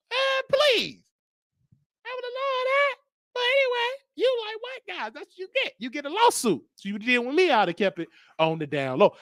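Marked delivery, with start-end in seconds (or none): 0.1-5.7 camp falsetto. Pretend.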